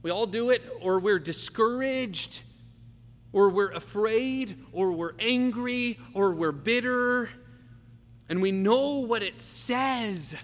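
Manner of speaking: slow, high, loud, drawn-out preaching; full-force preaching. Acted. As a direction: preach it slowly and with fire to the congregation.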